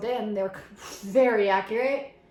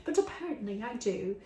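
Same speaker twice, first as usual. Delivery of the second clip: normal talking voice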